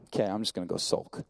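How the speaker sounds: low, soft, sulking mumble; sulky older son. Retold or imitated. Older son brushing off the father, sulking softly. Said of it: "he's like".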